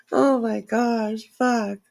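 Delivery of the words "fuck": ordinary talk, nothing marked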